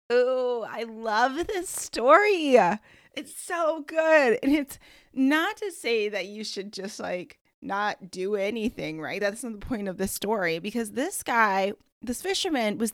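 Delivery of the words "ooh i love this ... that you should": delighted. Real, not acted. Host gushing over story.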